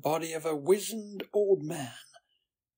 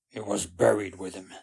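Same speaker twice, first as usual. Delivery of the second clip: low, quick gruff reply; gruff and brisk; 5 semitones lower, 4 dB louder, faster than usual. A narrator's reading. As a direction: answer gruffly, pitched down. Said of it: "said Flint gruffly".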